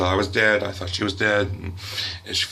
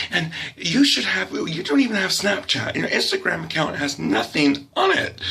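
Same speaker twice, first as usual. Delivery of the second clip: high-pitched, mounting motherly complaint; mock exasperated nagging; 5 semitones higher than usual. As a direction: imitate a mother working herself up. Mother berates child about social media.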